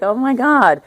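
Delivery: shocked delight at hearing her; surprised gasp. Retold or imitated. Recognizing an old friend calling, high delighted surprise.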